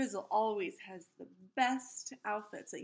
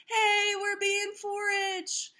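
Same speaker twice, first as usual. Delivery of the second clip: mock cry; 11 semitones higher, 6 dB louder, much slower than usual. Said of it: "cried Wanda".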